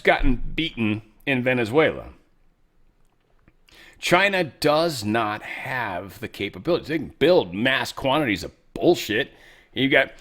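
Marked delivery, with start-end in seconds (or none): none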